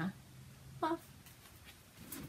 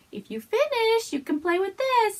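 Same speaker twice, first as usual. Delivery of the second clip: high, slow sing-song coaxing; teasing bribe; 13 semitones higher, 4 dB louder than usual. Pretend. Teasing herself with yarn as a reward, high sing-song. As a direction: dangle a reward in front of yourself.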